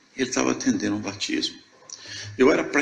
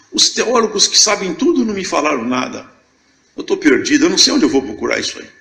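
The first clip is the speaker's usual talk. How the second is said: louder, rising despair, then rushing the last line; a lost man pleading with himself, louder; panicked; 6 semitones higher, 5 dB louder than usual. Retold or imitated. Lost believer's retold panicked inner cry.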